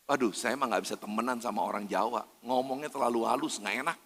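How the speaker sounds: low, quiet grumble; mock soft whiny complaint. Believer grumbling quietly that Javanese talk too softly. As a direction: sulk about soft-spoken people under your breath.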